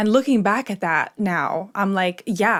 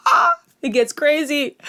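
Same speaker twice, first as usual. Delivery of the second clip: excited; 7 semitones higher, 5 dB louder than usual. Real, not acted. Building up the story twist.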